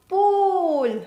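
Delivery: Pool with a long oo. Shown as a demonstration. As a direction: stretch the oo in Pool. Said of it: "Sound is long".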